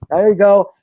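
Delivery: loud, rising encouragement to a friend being sick; cheering a friend on. Retold or imitated. Friend cheers on a buddy who is puking, loud encouragement. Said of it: "you're like".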